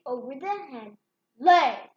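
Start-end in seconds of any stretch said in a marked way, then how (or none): 1.4-1.9 loud booming command; boomed. Acted. Giant commanding the hen to lay, booming. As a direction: boom it at the hen. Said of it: "boomed the giant".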